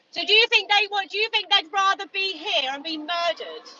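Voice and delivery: furious retorts thrown back at him; angry shouting. Real, not acted. Activist arguing with a passer-by, furious shout. Heated argument with meat eater.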